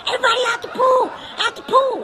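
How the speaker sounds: high, fast shout clearing the pool; lifeguard shout. Retold or imitated. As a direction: call everyone out, fast and high. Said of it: "other lifeguard start to blow".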